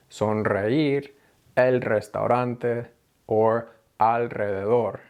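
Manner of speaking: sonreír, el restaurante, alrededor with trilled r; slow, rolled r after n and l; rolled r after consonant. Shown as a demonstration. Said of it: "R sound that comes after a consonant".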